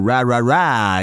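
"ra ra ra" with an English R; untrilled Anglo R in "ra ra ra"; English-accented R. Shown as a demonstration. Showing listeners the easier version, English-accented R. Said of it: "una R con la pronuncia ad esempio anglo".

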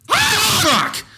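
very high, screamed outburst; yelled curse. Real, not acted. Host breaking off the movie rant, screamed curse.

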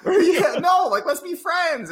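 very high, slow, defensive voice; mock innocent pleading. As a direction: squeak out that you mean no harm. Continuing innocent pleading, laughing.

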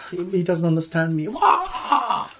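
mock crying whine, laughing, high. Teacher mocks affronted student with laughing whine. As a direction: whimper like a hurt child, half laughing.

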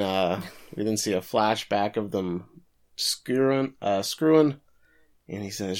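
ordinary delivery

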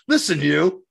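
sharp mock yell at a child; retold yell. Coach yelling at the child, loud. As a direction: bark it at the kid. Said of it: "yells at this child".

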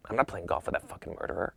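low, fast undertone; hushed refusal. Retold or imitated. Buddy refusing to play with O J, hushed and fast. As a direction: refuse quickly under your breath.

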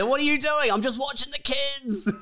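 hammed-up high-pitched excuse; mock protest. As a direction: squeal it like someone caught in the act. Acted challenge and guilty excuse.

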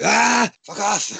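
high, drawn-out mock-panicked cry; mock fright, pitched high and stretched out; panicked recoil. Man mimicking everyone's reaction to scurrying things, panicked recoil.

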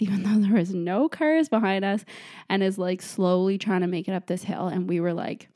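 usual speaking voice

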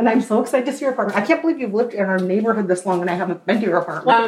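real excited gushing that speeds up; overwhelmed, rushing reunion chatter; overwhelmed, mile-a-minute. Real, not acted. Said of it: "overwhelmed Mile a Minute [name]".

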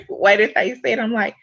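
normal talking voice

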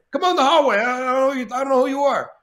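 high put-on De Niro voice; De Niro impression. De Niro telling Shane to come to the hallway, put-on voice. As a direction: do De Niro, gruff and brusque. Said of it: "He goes".

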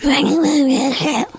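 put-on high, husky little-kid noise; imitated husky nephew. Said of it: "my nephew has that same voice ... his voice is like".

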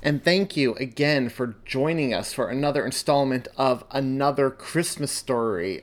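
plain reading voice

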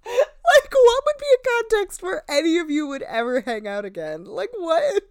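can't believe what you just heard; a real baffled outburst; incredulous. Real, not acted. Host baffled by the goodbye.

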